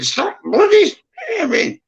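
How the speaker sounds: shrill-free high mock telling-off from mum; a son imitating his late mum's high scolding voice. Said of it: "I can hear her now going".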